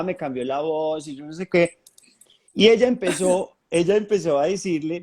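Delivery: ordinary delivery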